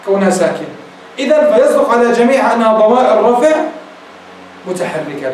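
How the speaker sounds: usual speaking voice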